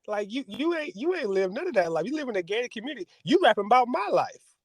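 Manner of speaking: high, scolding father mocking his rapper son; lightly voiced father mocking the son's lyrics; mocking dad. Voicing Michael Irvin scolding son.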